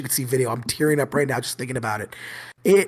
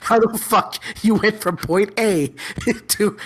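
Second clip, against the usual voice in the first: laughing; 5 semitones higher than usual. Real, not acted. Teacher retells questioning a student's logic, struggling through laughter. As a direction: try to question the student while cracking up. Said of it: "I'm laughing now".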